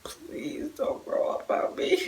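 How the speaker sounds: hushed, slow plea to her son not to grow up; pleading whisper. Retold or imitated. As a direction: whisper it like a secret wish. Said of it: "I was like".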